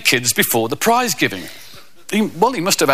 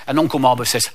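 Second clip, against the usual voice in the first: normal talking voice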